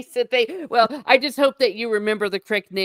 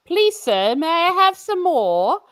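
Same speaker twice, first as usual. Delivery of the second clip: higher, slow Oliver Twist plea in mock British; bad British orphan accent; 8 semitones higher, 3 dB louder, slower than usual. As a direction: beg like a British workhouse orphan, rising at the end. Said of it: "I can't do the accent".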